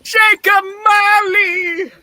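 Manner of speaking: high, loud calling shout; frightened cry. Acted. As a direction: cry out after the vanishing ghost. Scrooge crying out to ghost.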